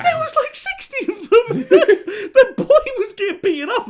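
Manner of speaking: high, loud laughing disbelief; laughing outrage. Real, not acted. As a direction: squeal it through real laughter. Exasperated laughing rant.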